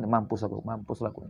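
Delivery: low, hushed, acted despair; worried muttering. Anxious man lamenting his doom to himself, hushed worry. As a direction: mutter it under your breath, anxious and defeated. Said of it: "cemas, khawatir".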